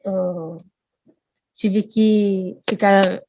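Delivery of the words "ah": ordinary talk, nothing marked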